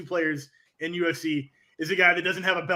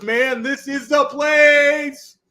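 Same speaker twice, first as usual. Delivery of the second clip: slow drawled fighter quote; 7 semitones higher, 7 dB louder, much slower than usual. Retold or imitated. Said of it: "Travis Lutter goes".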